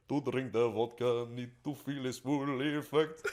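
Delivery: slow, hammed-up Russian accent with a laugh. Singer doing a Russian diplomat for a musical, Russian accent. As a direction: play a Russian diplomat toasting with vodka. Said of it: "Russian diplomat".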